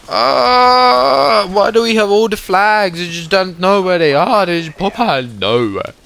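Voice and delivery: high, loud frustrated rant; loud annoyed complaint to the team; exasperated yelling. Real, not acted. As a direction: vent at the enemies popping up. Frustrated by hidden enemies.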